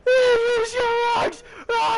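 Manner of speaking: high, drawn-out mock scream. Retelling a prank on a friend, high-pitched screaming. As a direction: scream it out loud and slow, way up high. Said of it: "I literally just started screaming and going".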